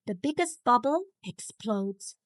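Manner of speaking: usual speaking voice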